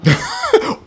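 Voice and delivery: shrill-free high cackle of mockery; a high taunting laugh; high mocking laugh. Angels laugh at the drowning, high mocking laugh. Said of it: "they be up there laughing".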